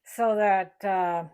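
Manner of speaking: words round a pin in the mouth. Real, not acted. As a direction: talk with a pin held in lips. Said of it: "got a pin in my mouth".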